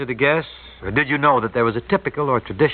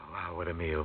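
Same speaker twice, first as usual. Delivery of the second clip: hushed, much softer "wow, what a meal", stress on "a"; awed hush; 7 semitones lower, 15 dB quieter than usual. Real, not acted. Host overwhelmed by the menu, hushed and awed. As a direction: breathe "wow, what a meal" almost to yourself.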